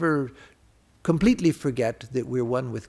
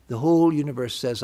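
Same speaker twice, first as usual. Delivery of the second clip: ordinary talk, nothing marked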